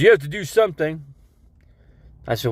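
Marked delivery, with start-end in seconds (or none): 0.0-1.0 nagging nurse. Retold or imitated. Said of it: "She goes".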